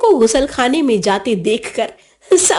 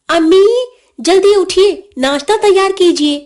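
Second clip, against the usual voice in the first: put-on higher-pitched, insistent child giving orders; raised, commanding little-boss voice; bossy wake-up call; 7 semitones higher, 4 dB louder than usual. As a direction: rouse a sleeper with brisk authority. Boy shakes mother awake, ordering.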